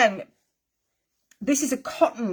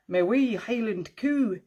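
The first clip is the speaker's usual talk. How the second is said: hammed-up, slow Scottish accent; bad Scottish accent. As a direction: put on a badly faked Scottish accent, drawn out. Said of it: "That was the worst accent ever".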